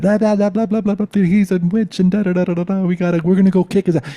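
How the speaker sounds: high, loud, rushed gibberish; mocking babble. Storyteller mimicking the drunk brothers' rant, mocking high babble. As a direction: rattle it off like a ranting mob.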